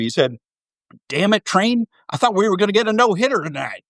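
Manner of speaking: Nolan Ryan Southern drawl. Retold or imitated. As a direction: put on a folksy Southern drawl like an old Texas pitcher. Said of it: "I'll do my best impression here".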